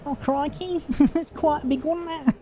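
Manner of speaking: very high-pitched, hammed-up Aussie accent; mock Australian accent. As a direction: put on a silly high Australian voice. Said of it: "apologise for my Australian accent".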